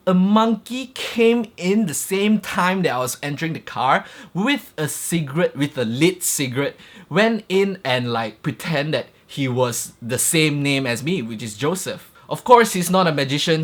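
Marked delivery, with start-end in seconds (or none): none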